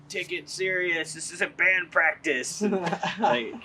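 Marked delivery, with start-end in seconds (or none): 0.1-2.6 high, drawn-out heckler shout; mock yell, stretched and raised in pitch; imitating drunk yell. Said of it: "he just yells".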